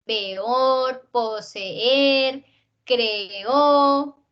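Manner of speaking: peor, poseer, creó with the two vowels held apart; syllable opened into hiatus. Shown as a demonstration. Teacher showing how the syllable opens, peor, poseer, creó in separated vowels. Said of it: "se abre la sílaba".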